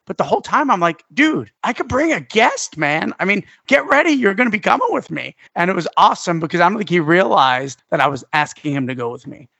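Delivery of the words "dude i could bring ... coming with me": high, thrilled and eager; bursting to spring the news on a friend; excited invite. Retold or imitated. Telling his friend he can come along, eager and excited.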